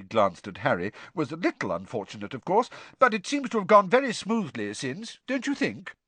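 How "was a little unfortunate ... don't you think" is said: put-on pompous young official, high and eager; self-important Percy, raised voice. Percy continues.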